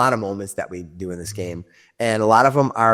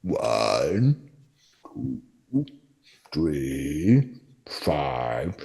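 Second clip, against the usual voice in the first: much lower, softer slow counting; dim ogre counting; 8 semitones lower, 8 dB quieter, much slower than usual. Acted. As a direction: count slowly like a dimwit.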